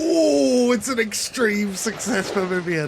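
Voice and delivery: sudden high, loud "Oh"; surprised cry. Real, not acted. Player cheering an extreme success roll, a surprised high shout. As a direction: yelp at the dice result.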